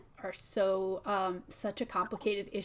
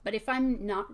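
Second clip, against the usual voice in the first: normal talking voice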